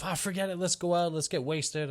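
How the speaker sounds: high-pitched mock party invitation; breezy dismissal. Acts out friend's advice after breakup.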